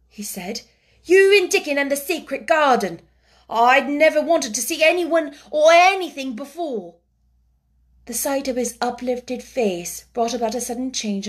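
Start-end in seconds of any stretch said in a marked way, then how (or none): 1.0-7.0 higher, louder shy eagerness; eager boy. A narrator's reading. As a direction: tell her you longed to see her. Colin voiced.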